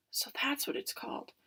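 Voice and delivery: soft unvoiced whisper. Awed bird whispering to herself. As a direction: breathe it out in awe.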